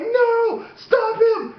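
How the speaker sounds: high tearful begging wail; mock child panic. Little kid begging dad for help, mock panic. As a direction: plead like a terrified kid, hammed up.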